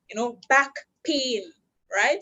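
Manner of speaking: usual speaking voice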